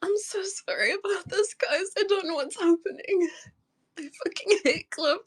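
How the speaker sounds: tearful and distressed, real sobs between phrases; crying. Real, not acted. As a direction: plead through tears. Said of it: "I'm just crying".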